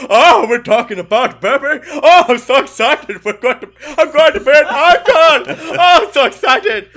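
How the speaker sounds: high, loud, laughing lemur king; King Julian voice. Retold or imitated. Excited about his friend's new baby, like King Julian. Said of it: "You are not King Julian".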